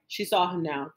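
usual speaking voice

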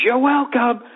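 high chanted reply, no real scream; mock crowd scream. Fans answering the chant leader, high mock-screamed reply. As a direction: imitate a crowd calling back in unison. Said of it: "everybody screams".

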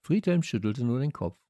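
plain reading voice

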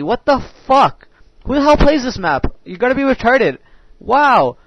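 real gamer rant, loud and high-pitched; exasperated outburst. Real, not acted. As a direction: raise your voice in incredulous exasperation. Ranting at the game map.

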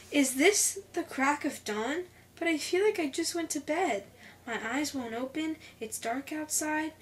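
groggy complaint. Acted. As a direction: protest as if barely awake. Girl woken too early, can't move.

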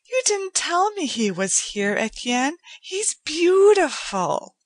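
put-on young woman enchanted by a pet; delighted young woman. A narrator's reading. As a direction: gush over the cat. Joanna delighted by the cat.

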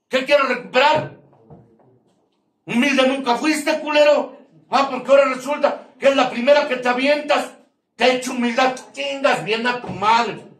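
high, loud furious rant at them; mocking, shouting tirade, pitch up high; angry yell. Mocking the recovery crowd, yelling.

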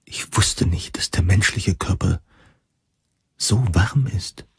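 tonloses, erschrockenes Flüstern; flüstere es, noch ganz verschreckt; geflüstert. Acted. Erinnert sich an den Schreck. (er erinnert sich flüsternd an die erste Berührung)

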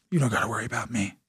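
low, soft, quick threat; understated menace in a retold warning; mock warning. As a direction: drop low and quiet, tossing off the threat. Mock warning to future son-in-law.

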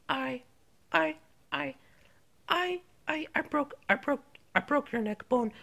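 soft, low, halting stammer; stall, then quietly own up; guilty mumble, stuck on the first word; sheepish confession. Acted. Reluctant confession of breaking neck.

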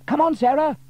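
put-on high, loud boy's call; young boy calling loudly; James calling out. A narrator's reading. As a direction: call to his sister to hurry. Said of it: "called James".

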